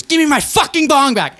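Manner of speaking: high, shrill angry demand; angry girlfriend voice. Retold or imitated. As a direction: shriek it like a furious girlfriend. Girlfriend threatens with knife.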